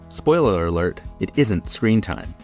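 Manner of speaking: usual speaking voice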